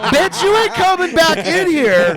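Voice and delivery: high, loud, drawn-out refusal; laughing mock shout. Bouncing the bartender outside, mock incredulous shout. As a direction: yell it incredulously through laughter. Retells refusing her re-entry.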